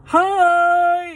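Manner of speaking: very high, loud cheery hi; a squeaky yuppie hello; chirpy greeting. Retold or imitated. Yuppie kayakers calling out, very high and loud.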